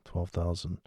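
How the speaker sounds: ordinary talk, nothing marked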